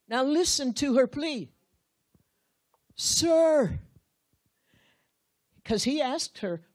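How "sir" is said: high, loud pleading "Sir"; pleading Mary. Acted. Grieving Mary cries out to the stranger, high and loud. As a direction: cry out to him as grieving Mary. Mary's plea acted out.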